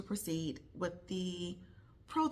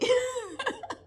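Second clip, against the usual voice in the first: high burst of laughter; 7 dB louder than usual. Real, not acted. Laugh after talking about her family.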